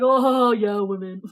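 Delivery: loud, drawn-out scoffing agreement; loud mock jeer, then dropping off; mock jeering men. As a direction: imitate a crowd of men jeering along. Retold men jeering along with the dad.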